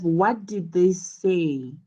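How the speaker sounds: slow question with "say" emphasized and falling at the end; stress on SAY, falling. Shown as a demonstration. Teacher modelling a what question, emphasized "say" with falling intonation. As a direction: draw out "say" and let the pitch drop. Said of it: "notice how say is emphasized".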